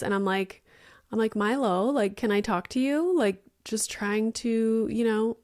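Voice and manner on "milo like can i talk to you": usual speaking voice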